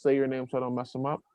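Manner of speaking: normal talking voice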